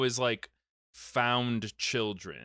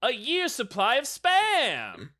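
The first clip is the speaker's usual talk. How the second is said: booming, slow game show announcer, much higher and louder; game show host; 11 semitones higher, 6 dB louder, slower than usual. Pretend. Joking to friends as a hammed-up game show host. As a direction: belt it out like a TV prize host.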